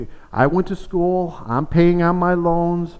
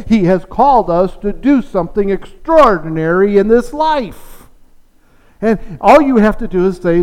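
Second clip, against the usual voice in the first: louder, higher, rousing pulpit delivery; fiery preaching; 4 semitones higher, 6 dB louder than usual. Acted. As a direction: preach it to the pews, full force. Pastor preaching to the congregation.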